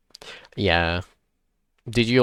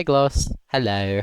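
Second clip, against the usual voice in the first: ordinary talk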